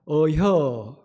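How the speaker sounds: short Chungcheong "어혀", low and clipped; 충청도 사투리로 짧게 "어혀"; Chungcheong dialect. Shown as a demonstration. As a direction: toss off "어혀" the Chungcheong way. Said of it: "충청도 사람들은".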